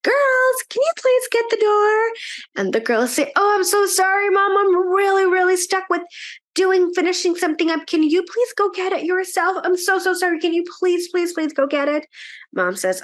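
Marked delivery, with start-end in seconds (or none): 0.0-2.1 calling out. A narrator's reading. Mom calling the girls to answer the door. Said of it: "she yells". 3.4-12.1 put-on pleading girls. A narrator's reading.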